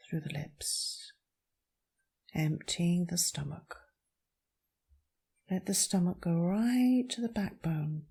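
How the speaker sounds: calm meditation voice with long pauses; guided meditation. Acted. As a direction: guide them gently through each breath, leaving space. Leads a breathing meditation.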